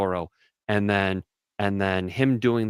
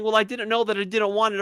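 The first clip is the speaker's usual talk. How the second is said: mock girlfriend excuse; 10 semitones higher than usual.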